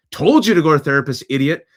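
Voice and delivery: mock-scold like a fed-up wife. Wife scolding husband who finally went to therapy, loud and exasperated. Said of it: "my wife was like".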